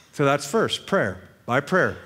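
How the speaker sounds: normal talking voice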